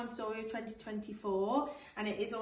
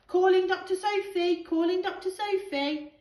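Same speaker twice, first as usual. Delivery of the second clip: higher, louder radio announcement; playful emergency radio call; 8 semitones higher, 9 dB louder than usual. Acted. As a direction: call over the radio like a pilot. Luther radioing for help.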